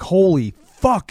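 stunned disbelief, drawn out; shocked. Retold or imitated. Will sees kid on tower.

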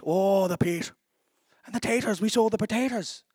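light imitation of an Irish accent. Pastor imitating Irish colleague, light Irish accent. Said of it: "and he's Irish".

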